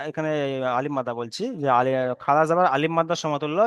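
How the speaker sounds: ordinary talk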